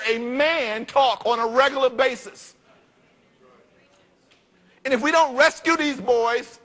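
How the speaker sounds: rally the men of the church, pressing hard; preaching at full force. Acted.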